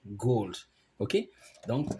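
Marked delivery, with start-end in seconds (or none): 0.1-0.5 GOLD with a long American O; American-style GOLD, O held long. Shown as a demonstration. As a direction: draw out the O in GOLD. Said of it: "un O qui est long".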